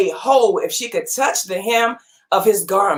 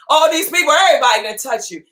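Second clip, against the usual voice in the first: quick amused crowd complaint; fast mock protest; 3 semitones higher, much faster than usual. Laughing retelling of crowd.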